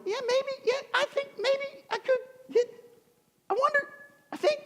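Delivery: very high, tentative mock musing; hammed-up tentative giving in; hesitant wavering. Acted wavering as he gives in.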